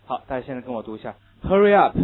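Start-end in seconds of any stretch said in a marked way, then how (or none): 1.4-2.0 loud American "Hurry up", r-coloured schwa in Hurry; American English. Shown as a demonstration. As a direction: model "Hurry up" loudly with American vowels. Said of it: "这是美式读法". (美式发音大声读Hurry up)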